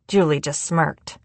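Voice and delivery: plain reading voice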